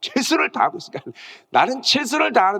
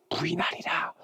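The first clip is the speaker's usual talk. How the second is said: whispered prophecy; 9 dB quieter than usual. Acted. 예수님 말씀 인용.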